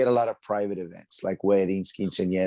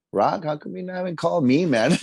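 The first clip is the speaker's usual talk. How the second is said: higher, playful protest; put-out but joking; mock complaint; 9 semitones higher, 3 dB louder than usual. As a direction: complain in jest, pitched up. Acting out own complaint to host.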